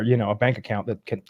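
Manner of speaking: normal talking voice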